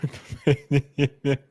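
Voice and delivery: a sudden helpless laugh. Real, not acted. Streamer cracks up laughing at the chat. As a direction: burst of real laughter. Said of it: "I just laugh".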